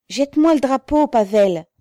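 usual speaking voice

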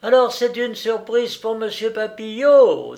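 high, loud character voice; engraver's voice. A narrator's reading. Engraver asking the wife about her surprise, high voice. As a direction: ask it loudly in a high put-on voice. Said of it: "dit le graveur".

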